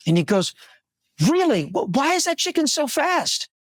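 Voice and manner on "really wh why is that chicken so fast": high, astonished question; amazed driver. Acted. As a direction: play the stunned driver, pitch up. Driver asks farmer in joke.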